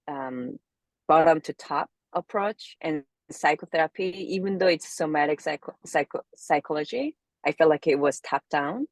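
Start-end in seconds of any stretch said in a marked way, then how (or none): none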